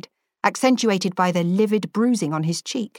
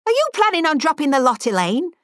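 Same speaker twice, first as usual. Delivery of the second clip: put-on high, pointed old lady's demand; sharp old spinster voice; stern elderly lady; 9 semitones higher, 3 dB louder than usual. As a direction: tart old woman needling the girl.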